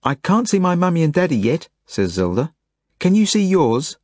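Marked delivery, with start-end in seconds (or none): 0.0-1.7 put-on high, eager child's voice; little girl voice. A narrator's reading. Little Zelda on the beds. 3.0-4.0 put-on high, eager child's voice; little girl voice. A narrator's reading. Little Zelda on the beds.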